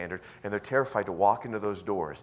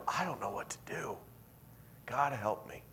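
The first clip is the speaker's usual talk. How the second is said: desperate plea; 2 semitones higher, 7 dB quieter than usual. Retold or imitated. Acts desperate prayer on ground.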